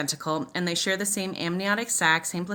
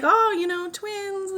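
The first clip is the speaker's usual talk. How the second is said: much higher, teasing lilt; hammed-up cheerful announcement; upbeat doctor; 13 semitones higher, slower than usual. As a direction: mimic the doctor breezily, voice pitched up. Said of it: "he's like".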